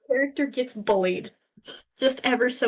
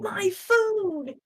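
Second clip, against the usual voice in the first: much higher, delighted food cheer; very excited; 9 semitones higher than usual. Real, not acted. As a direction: squeal it with glee.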